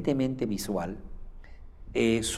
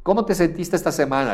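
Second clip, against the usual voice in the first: upbeat question; 7 semitones higher, 5 dB louder, faster than usual. Shown as a demonstration. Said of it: "le preguntas".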